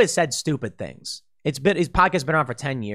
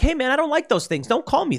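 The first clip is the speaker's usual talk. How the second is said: much higher, pleading voice; defensive protest; 10 semitones higher than usual. Retold or imitated. Accused person protesting to an accuser, higher pleading tone. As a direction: play a wounded guy brushing off an accusation. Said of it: "And you're like".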